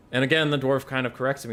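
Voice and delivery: usual speaking voice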